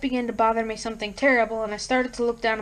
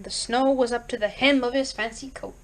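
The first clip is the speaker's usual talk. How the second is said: plain reading voice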